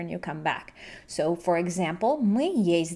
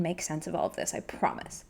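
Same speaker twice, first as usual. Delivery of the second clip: whispered promise; 4 semitones lower, 9 dB quieter than usual. Real, not acted. Reassuring learners.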